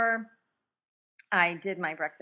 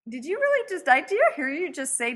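retold disbelief; 8 semitones higher than usual. Husband's disbelief at her reply, retold.

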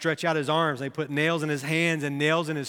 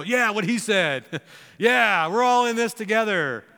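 higher, louder mock bravado of followers; disciples chiming in; 5 semitones higher, 4 dB louder than usual. Other disciples back up Peter, chiming in loudly. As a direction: play the guys eagerly agreeing. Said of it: "all the other guys go".

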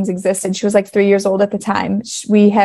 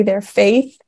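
ordinary talk, nothing marked

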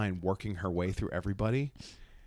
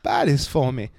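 lightly voiced accented woman shopper; louder retold request in her accent; imitating woman customer; 3 semitones higher, 5 dB louder, slower than usual. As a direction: mimic her asking the next person. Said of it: "she goes".

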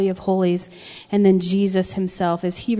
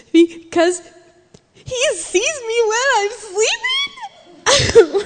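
high-pitched mock toddler wail; wailing small child; 13 semitones higher than usual. Crying daughter explaining to her parents, high tearful wail. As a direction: wail it like a terrified, crying three-year-old. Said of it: "she's weeping in her bed".

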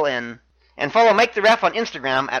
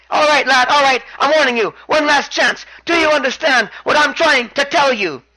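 high, raised-voice last warning; emphatic mock threat, pitched up throughout; imitated angry warning; 6 semitones higher, 4 dB louder than usual. Champion gives challenger one last chance, high exasperated imitation.

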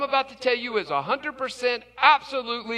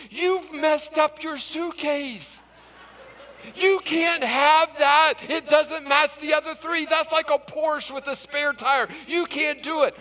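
mock dismay; 6 semitones higher than usual. Clerk scolding over wheel.

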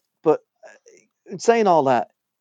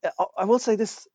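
usual speaking voice

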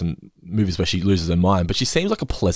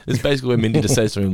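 ordinary talk

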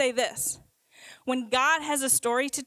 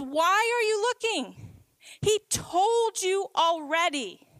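angel's question; 6 semitones higher than usual. Acted. Angel questioning the frightened women at the tomb, raised and rebuking. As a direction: lift it up like an angel gently reminding. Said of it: "And the angel says".

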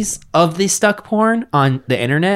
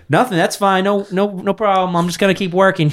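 higher-pitched parody plumber; mock polite brush-off; 7 semitones higher than usual. Plumber turns down the lady's thanks, mock casual brush-off. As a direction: wave it away, acting the oblivious workman.

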